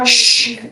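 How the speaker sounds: long sharp "shh"; shushing. Real, not acted. Hushing a noisy courtroom before a plea, shushing. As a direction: hush the room with a drawn-out shh.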